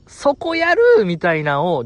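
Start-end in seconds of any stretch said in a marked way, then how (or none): 0.1-0.8 high-pitched mock heckle; acted, high gleeful interjection; excited heckle. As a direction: imitate an overexcited onlooker calling out. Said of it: "誰よりも大きい声で言ってた、 はしゃいでた".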